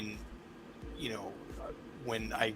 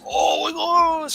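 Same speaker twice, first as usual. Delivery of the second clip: much higher, slow, drawn-out awe; amazed kid; 8 dB louder, much slower than usual. Retold or imitated. A dad imitating his son's slow, high, awed gasp. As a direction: stretch it out like a stunned kid. Son sees the comics.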